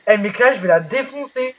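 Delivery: high, loud, outraged cursing; angry outburst. Real, not acted. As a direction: vent loudly about someone who told. Furious about classmate who told on him.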